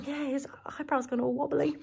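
fast whispered aside. Real, not acted. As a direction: lean in and whisper it. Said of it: "aside whispered".

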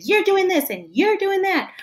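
high-pitched, nagging accusation; accusing you-message. Acted. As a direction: lean hard on each YOU like you're picking a fight. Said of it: "If you say it in a nicer way".

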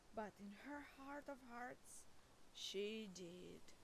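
a quiet sad reveal; hushed sad aside. A narrator's reading. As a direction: let it drop quietly.

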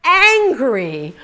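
hammed-up husband scolding her; husband's reproach. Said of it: "And he was like".

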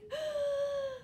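high sharp gasp of fake fright; mock frightened gasp. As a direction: gasp as if the troll scared you.

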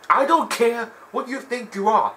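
hammed-up strict referee; stern referee warning. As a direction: scold the contender firmly. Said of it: "he was like".

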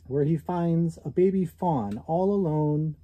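usual speaking voice

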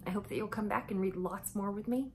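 ordinary delivery

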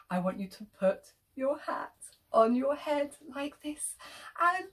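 plain reading voice

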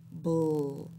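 "bull" cut short to its "bl" onset; isolated onset "bl" for children; beginning sound isolated. Shown as a demonstration. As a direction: clip it to the opening blend. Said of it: "tell me the beginning sound".

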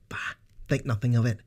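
high, fast, friendly brush-off; warm. Acted. Narsil waves off Sarah's thanks, quick and warm. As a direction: wave off thanks warmly. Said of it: "he replied warmly".

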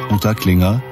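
usual speaking voice

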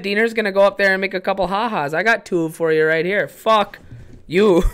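loud, high hoser heckling; jeering townie. Acted. Hometown guys mock Diener's comedy tour, loud and jeering. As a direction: razz him like a drunk local at the bar.

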